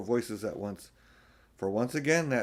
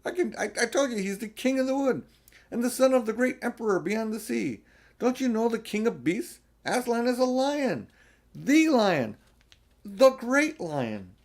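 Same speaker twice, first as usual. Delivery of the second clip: high, loud, fast and insistent; stern proud declaration, slowing at the end; 13 semitones higher, 7 dB louder than usual. A narrator's reading. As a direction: lecture them firmly on the king. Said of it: "said Mister Beaver sternly".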